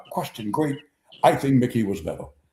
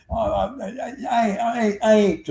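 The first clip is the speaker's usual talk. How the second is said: stammered reply, pitched way up; Mickey Mantle; 12 semitones higher, slower than usual. Retold or imitated. Mantle brushing off fawning fans, high stammering impression. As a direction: stumble over the first word in a high put-on voice.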